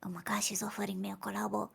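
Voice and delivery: excited soft whisper. Real, not acted. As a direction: whisper it in delight. Whispering aside.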